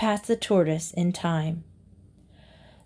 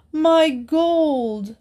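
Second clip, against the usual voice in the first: desperate wail; 7 semitones higher than usual. A narrator's reading. Miser crying out again for his lost gold, desperate wail. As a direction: let the second cry break higher in despair. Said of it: "cried the miser wildly".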